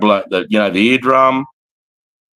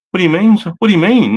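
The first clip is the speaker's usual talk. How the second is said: mock whiny recruit; 7 semitones higher, 5 dB louder, faster than usual. Mocking recruit's protest.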